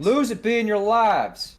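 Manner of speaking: high, loud, drawn-out yell; shouted command, stretched out slow; in-character scream. Pretend. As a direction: keep hollering the battle cry. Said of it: "I'm gonna just scream out again".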